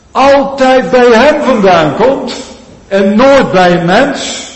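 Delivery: loud, high insistent preaching; climactic appeal. Acted. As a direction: drive the point home. (luid en hoog aandringend)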